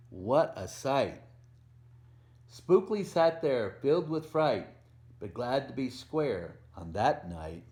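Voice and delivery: lively picture-book read-aloud with a bouncy rhythm; reading to children. A narrator's reading. Volunteer reading a Halloween book to kids, storytelling voice.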